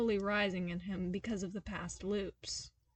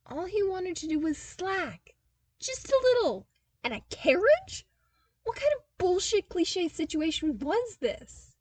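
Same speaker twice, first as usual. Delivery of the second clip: exasperated rant; 9 semitones higher, 8 dB louder than usual. Acted. Narrator voices Cale's exasperated rant.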